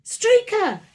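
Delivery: high, loud frightened cry; alarmed cry. A narrator's reading. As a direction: cry out the dog's name in alarm. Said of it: "cried Mum".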